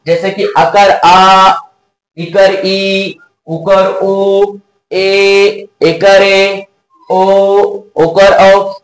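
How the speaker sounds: slow, held vowel demo for the class; drawn-out long vowels. Shown as a demonstration. Teacher to pupils, holding each long vowel slowly. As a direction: stretch each vowel आ, ई, ऊ, ए, ऐ, ओ, औ to twice its length. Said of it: "ये सब जो स्वर को आप बोल रहे, सर, तो इसमें दो मात्रा का समय लग रहा है".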